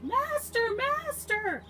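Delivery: very high, loud shriek; high-pitched storybook scream; screaming harp. Acted. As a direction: scream out for help in a piercing high voice. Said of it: "when it screamed".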